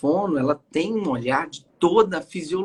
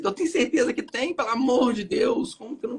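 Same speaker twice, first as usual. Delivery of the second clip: indignant disbelief; 9 semitones higher than usual. Retold or imitated. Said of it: "aquele negócio que eu falo assim".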